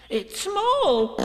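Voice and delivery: very high, excited Piglet; thrilled little creature's cry. Acted. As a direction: squeal the discovery. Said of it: "cried Piglet".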